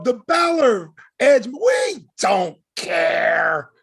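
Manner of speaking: slow, heavily drawn-out exasperation; stretched-out, emphatic dismissal; fed-up outburst. Real, not acted. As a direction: drag each word out in frustration. Fed-up outburst about the match.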